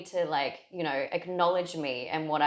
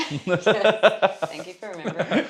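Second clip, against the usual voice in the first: laughing; 3 semitones higher, 8 dB louder than usual. Real, not acted. Friend remembers her birthday.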